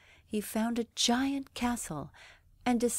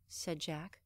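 usual speaking voice

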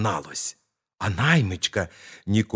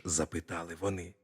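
usual speaking voice

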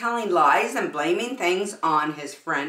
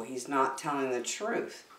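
hushed aside; 5 semitones lower, 10 dB quieter than usual. Acted. Aside about the fib.

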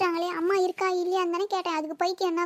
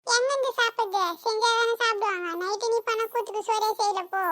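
put-on raised, higher pitched kid's retort; sulky son; 5 semitones higher than usual. Son telling father there was no dinner, higher sulky retort. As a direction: answer back like a sulking boy.

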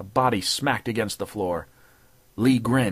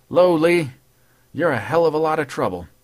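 cheery cop; 6 semitones higher, 6 dB louder than usual. Acted. Patrolman's cheery greeting.

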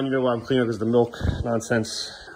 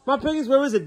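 lightly voiced excited little boy, pitched higher; imitating his son; 12 semitones higher than usual. Dad imitates his son's excited chatter about his feet, lightly. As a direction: play the kid showing off his piggies, higher. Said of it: "He's like".

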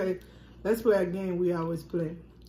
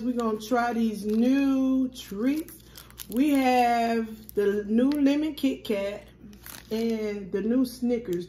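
slow, loud, building up the reveal; slow and drawn out; 3 semitones higher, 4 dB louder, slower than usual. Real, not acted. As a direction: stretch it out for the camera. Announcing treats to viewers.

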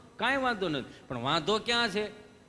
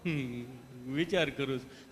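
low, slow, hesitant humming; stammering patient; 6 semitones lower, 3 dB quieter than usual. Retold or imitated. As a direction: drag it out, stuck on each word. Gabho stammering reply.

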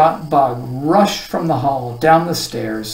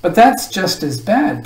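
higher-pitched objection; protesting; 5 semitones higher, 4 dB louder than usual. A narrator's reading. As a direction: object with a touch of dismay. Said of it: "protested Milo".

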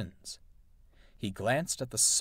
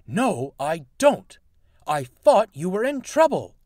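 cross; 7 semitones higher, 9 dB louder than usual. A narrator's reading. Grumpy engine snapping back at Peter.

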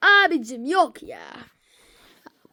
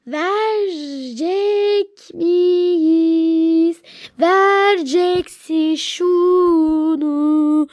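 drawn out; much slower than usual. Acted. Yavaş, uzatılmış ısrar.

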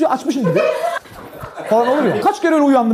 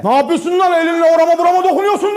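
angry shout; 5 semitones higher, 3 dB louder than usual. Retold or imitated. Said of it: "Herkesin duyacağı sesle".

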